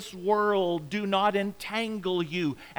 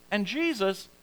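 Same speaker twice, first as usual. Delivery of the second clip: usual speaking voice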